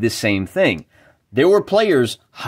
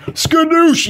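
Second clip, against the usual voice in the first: high, loud showman's cry of Skadoosh; triumphant exclamation; 4 dB louder than usual. Acted. Host revealing the answer is in the book, triumphant cry. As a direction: shout it like a magic reveal.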